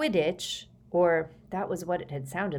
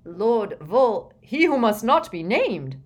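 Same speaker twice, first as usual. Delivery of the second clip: louder, raised-pitch snarl; 4 semitones higher, 8 dB louder than usual. A narrator's reading. Fudge corrects the Prime Minister over the forbidden name, snarling. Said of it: "snarled Fudge".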